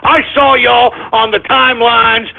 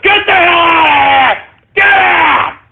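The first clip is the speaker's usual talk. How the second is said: furious high yell; shouting them out of the room; angry shout; 8 semitones higher than usual. Real, not acted. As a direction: drive them out with a roar. Ranting, telling people to leave.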